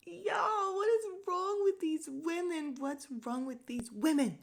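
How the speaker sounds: high, loud ranting disbelief; high, loud what is wrong outcry; exasperated outcry. Real, not acted. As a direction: throw up your hands and shout it. Ranting at the women.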